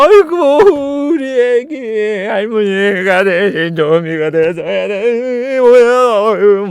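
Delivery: high, very slow drawn-out lament in a put-on old woman's voice; mock wailing grandma. Grandma crying over her zombie granddaughter, hammed-up slow wailing. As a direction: wail like a grieving grandmother. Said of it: "막 이렇게 울어 막".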